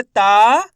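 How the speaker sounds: ता called out loud with a long आ; loud syllable demo. Shown as a demonstration. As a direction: punch and stretch ता. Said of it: "ता का उच्चारण खींच को हो रहा". ("ता" को ज़ोर से खींचकर बोलें)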